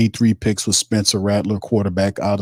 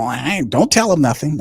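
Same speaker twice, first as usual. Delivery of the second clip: usual speaking voice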